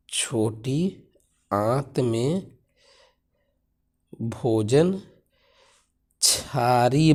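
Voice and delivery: slow classroom dictation. Shown as a demonstration. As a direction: give students time to write. Teacher dictating answer to class.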